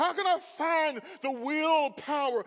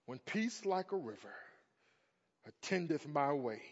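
hymn recitation; 4 semitones lower than usual. Acted. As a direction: read the old hymn gently and slowly. Reads hymn poem aloud.

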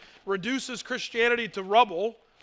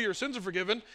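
offhand quiet; 2 semitones lower, 7 dB quieter, faster than usual. Shown as a demonstration. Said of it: "'cause I can say to you".

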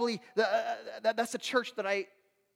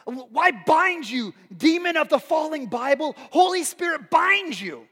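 loud, high, drawn-out binding prayer; mock fervent prayer; 5 semitones higher, 7 dB louder, slower than usual. Churchgoer praying against a fallen Bible, mock fervor. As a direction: imitate an overzealous exorcist, booming each phrase. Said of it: "immediately they're praying and saying".

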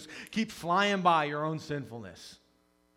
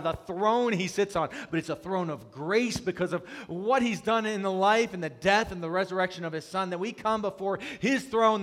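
loud, raised, impassioned sermon delivery; full-force preaching; 6 semitones higher, 9 dB louder than usual. Real, not acted. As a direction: proclaim it with force to the congregation.

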